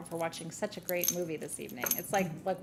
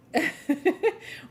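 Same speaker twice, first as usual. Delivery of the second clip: loud burst of laughter; 10 semitones higher, 9 dB louder than usual. Real, not acted. Excited rave about favourite movie.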